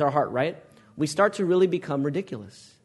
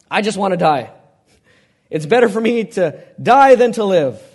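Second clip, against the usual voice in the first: loud, anguished cry of wanting to die; despairing Jonah; 3 semitones higher, 11 dB louder than usual. Acted. Preacher voicing Jonah's death wish to the congregation, anguished and loud. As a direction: wail it out like a man who has given up. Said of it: "until Jonah says".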